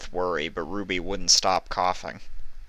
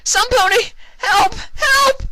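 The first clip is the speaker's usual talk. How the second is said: piercing high panicked yells; yelling for help; 9 dB louder, slower than usual. Acted. Vinyl calling for help, very high panicked yells. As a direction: scream for help at the top of your lungs. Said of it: "she yelled".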